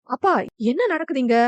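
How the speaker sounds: ordinary delivery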